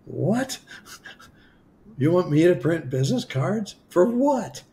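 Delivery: high, baffled disbelief; incredulous. Retold or imitated. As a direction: act out total confusion at the advice. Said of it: "And I'm like".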